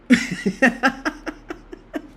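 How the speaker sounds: long high laugh rising; can't stop giggling; real laughing fit. Real, not acted. Cracking up at chat.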